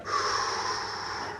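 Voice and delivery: long, soft unvoiced sigh; weary sigh. Paramedic reading the machine, weary sigh. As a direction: let out a puzzled sigh.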